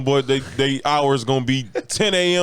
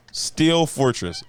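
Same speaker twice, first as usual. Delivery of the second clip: ordinary talk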